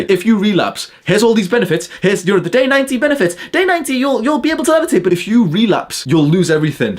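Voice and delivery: high, sing-song parody of YouTubers; mocking YouTuber. Mocking YouTubers' benefit promises, high sing-song parody. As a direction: lay out the promises like a mocking salesman. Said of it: "that's what they say".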